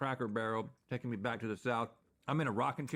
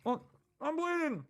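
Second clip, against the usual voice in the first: mock alarm; 13 semitones higher than usual. Mock alarm at red sauce.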